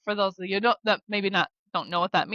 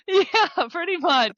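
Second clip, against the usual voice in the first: real laugh bursting into a high, loud yeah; giggly, amused agreement; 10 semitones higher, 5 dB louder, slower than usual. Real, not acted. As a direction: laugh it out, pitch jumping up. Laughing at the memory.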